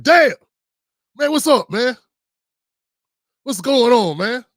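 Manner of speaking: loud, high, alarmed exclamations; freaking out. Retold or imitated. Host replaying his computer panic, loud and freaking out. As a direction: act out a panicked outburst. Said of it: "freaking out".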